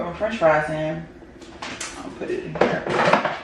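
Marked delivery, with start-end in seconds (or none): 2.0-3.3 whispered aside. Real, not acted. Mumbled aside while eating.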